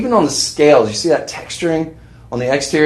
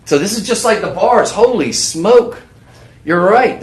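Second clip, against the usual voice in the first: excited surprise; 8 semitones higher than usual. Real, not acted. Sudden realization about silver purity.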